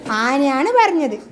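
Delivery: usual speaking voice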